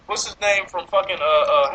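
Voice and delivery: ordinary talk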